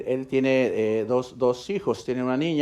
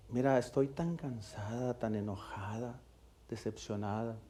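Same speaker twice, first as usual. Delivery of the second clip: much softer and slow, drained; exhausted and hurt; 9 dB quieter than usual. Retold or imitated. The wife confessing to her husband, drained and quiet. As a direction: let the fatigue weigh every word.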